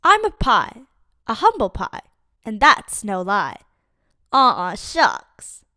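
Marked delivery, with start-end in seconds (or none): none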